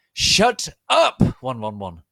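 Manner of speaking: very loud, high shouted command; roar. Acted. Lupin silencing the arguing crowd, loud roar. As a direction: bellow it to silence everyone. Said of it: "Lumpkin roared".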